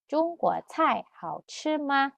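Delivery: "中国菜好吃吗?" said slowly with clear tones, rising question; slow Mandarin model. Shown as a demonstration. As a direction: model the sentence slowly for learners. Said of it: "ikutin yang B-nya juga ya".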